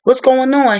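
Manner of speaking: retold concern, pitched well up; worried questioning. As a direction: act out an alarmed partner checking in. Husband's worried questions, acted out.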